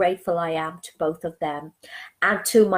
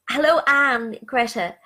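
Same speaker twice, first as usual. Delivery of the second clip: ordinary delivery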